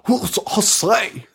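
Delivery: high, stretched-out hillbilly voice; over-the-top hillbilly. Acted. Imitating Englund in Eaten Alive, hammy hillbilly. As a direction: ham up a cartoon hillbilly, slowly. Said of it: "this over-the-top hillbilly".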